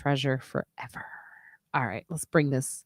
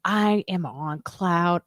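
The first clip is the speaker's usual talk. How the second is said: dreamy awe; 2 semitones higher, much slower than usual. Retold or imitated. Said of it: "And I was like".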